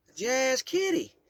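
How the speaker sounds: high, loud, slow Kentucky insistence; Southern Kentucky accent. Acted. Great-grandmother repeating herself to the child, loud Southern Kentucky accent. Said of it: "A deep Southern Kentucky accent".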